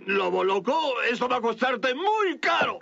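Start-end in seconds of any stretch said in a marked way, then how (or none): none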